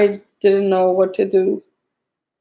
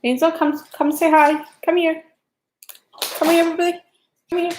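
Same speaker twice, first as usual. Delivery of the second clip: coaxing a pet; 7 semitones higher, faster than usual. Real, not acted. Calls her pet to the camera.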